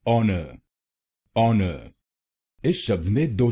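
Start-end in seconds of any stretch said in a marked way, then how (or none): 0.1-1.9 ऑनर with a silent h, sounding like on-er; ऑनर said with the h dropped, starting on the vowel; honor said right. Shown as a demonstration. Teacher modelling ऑनर with no h sound for learners. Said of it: "इसकी सही pronunciation है".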